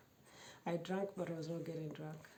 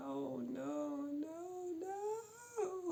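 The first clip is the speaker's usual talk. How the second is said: climbing higher each no; pleading no going up in pitch; rising panicked no; 11 semitones higher, much slower than usual. Retold or imitated.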